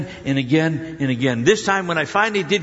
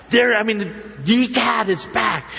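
stunned exclamation; 6 semitones higher, slower than usual. Retold or imitated. Seeing the cat in the street again, slow stunned cry. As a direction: draw it out like you can't believe it.

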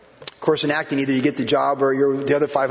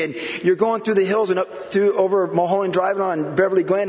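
ordinary talk, nothing marked

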